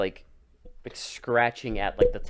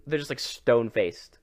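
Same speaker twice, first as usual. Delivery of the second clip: normal talking voice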